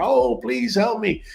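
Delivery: high, begging mock plea; pleading owner. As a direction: imitate a desperate owner asking for help. Retold plea from dog owners.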